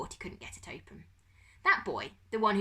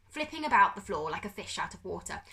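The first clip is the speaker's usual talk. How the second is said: ordinary delivery, nothing marked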